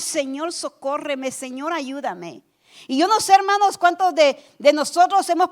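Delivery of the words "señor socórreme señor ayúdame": soft, hushed plea to the Lord; quiet prayer. Pretend. Believer quietly begging the Lord for help, hushed. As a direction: murmur it like a desperate prayer. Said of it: "le decimos".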